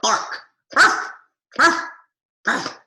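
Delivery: short, sharp, high puppet barks; dog puppet barking. Pretend. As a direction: bark in quick high yaps. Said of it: "he is going to".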